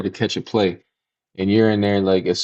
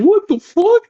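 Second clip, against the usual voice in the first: very high, louder mock shriek; shrieking friend imitation; 6 dB louder, slower than usual. Friend sees the girl's face, shrieking high and loud. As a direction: squeal like a shocked friend.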